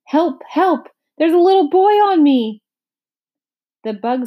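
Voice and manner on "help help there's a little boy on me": much higher and louder panic; frantic, squashed cry for help; shouting for help. A narrator's reading. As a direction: cry out from under the boy. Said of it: "lay there shouting".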